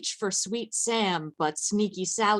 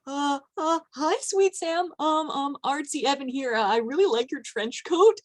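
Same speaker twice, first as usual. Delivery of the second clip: flustered high voice; 6 semitones higher than usual. Acted. Nervous Evan greets Sweet Sam.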